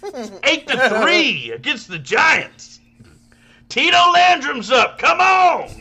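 high excited yelling at the ballpark; rowdy fan shout. Retold or imitated. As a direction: whoop it up like a drunk fan at a game. Acting out dad at ballgame.

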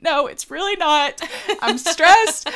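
retold upset outburst. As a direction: replay her own stressed-out snap at the boyfriend, half-laughing. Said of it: "I was so mad".

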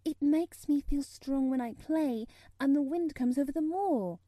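put-on high, loud, insistent little girl; arguing. A narrator's reading. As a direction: argue your case like an eager child. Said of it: "argued Mary".

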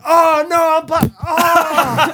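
high, loud mock wail; hammed-up cries of being blinded; playing blind dad. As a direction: wail it high, pretending you can't see. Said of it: "I was so into character".

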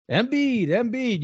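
high-pitched, drawn-out name repeated; mock buzz. Host imitating coming MVP talk about Embiid, high mock buzz. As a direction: imitate the rumour going round, voice lifted high.

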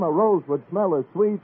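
ordinary talk

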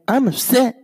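high-pitched pouty burst; mock rapper whine. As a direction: squeak it out, mocking the complaint. Repeating mocked rapper line.

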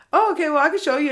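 bright helpful guard voice; friendly offer. Retold or imitated. Museum security guard kindly offering to show her around, upbeat.

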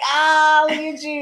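mock proud praise. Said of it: "And I'm like".

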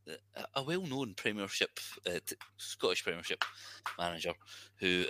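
ordinary delivery

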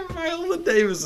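words pushed out through laughter; can barely get it out for laughing; half-crying with laughter. Real, not acted. Cracking up at the casting.

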